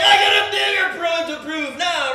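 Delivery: high, loud indignant yell; defiant shout. Retold or imitated. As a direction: shout it, fired up to make a point. Retold defiant retort.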